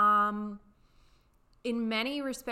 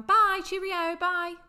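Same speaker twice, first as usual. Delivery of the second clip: high, light parting call; high-pitched demonstration of a casual send-off; everyday British farewell; 8 semitones higher, 6 dB louder, slower than usual. Shown as a demonstration. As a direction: toss off a sing-out goodbye. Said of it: "People will even use it like".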